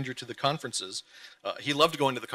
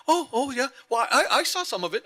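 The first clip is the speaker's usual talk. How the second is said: sheepish husband impression; 10 semitones higher, 3 dB louder than usual. He's like.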